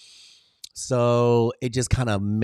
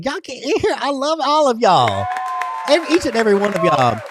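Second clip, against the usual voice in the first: much higher and louder, a delighted call; excited welcome; 12 semitones higher, 5 dB louder than usual. Real, not acted. Welcoming everyone in the chat, a high excited call. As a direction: throw open the door and call everyone in.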